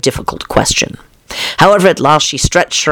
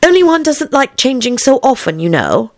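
put-on high girlish explanation; young Alice; 11 semitones higher than usual. A narrator's reading. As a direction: explain politely, pitch raised till the end.

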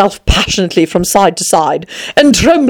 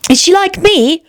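put-on high, eager girlish question; 11 semitones higher than usual. A narrator's reading. Alice hoping for another like her, eager. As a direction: pitch it up with keen interest. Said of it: "Alice asked eagerly".

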